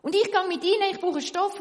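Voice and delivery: loud, high eager call. Acted. Tailor calling to the weaver, loud call. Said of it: "ruft der Schneider zum Weber".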